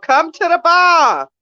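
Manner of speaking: high, loud, slow tipsy voice; mock drunk friend. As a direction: mimic a tipsy friend calling her out. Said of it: "talk about".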